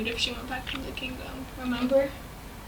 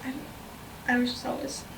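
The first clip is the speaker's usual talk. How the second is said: whispered confusion; 9 dB quieter, slower than usual. Retold or imitated. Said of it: "I'd be like".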